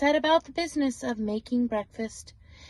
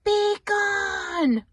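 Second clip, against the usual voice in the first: higher, louder bellowed command; roaring shout; 8 semitones higher, 4 dB louder than usual. Acted. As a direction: roar him out of the house. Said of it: "roared the bear".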